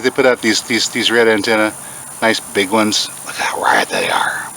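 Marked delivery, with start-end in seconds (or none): 3.3-4.5 slow whispered marvel; hushed awe at the antennae. Real, not acted. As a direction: breathe it out in awe.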